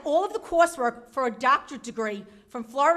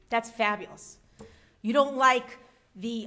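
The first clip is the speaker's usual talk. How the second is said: usual speaking voice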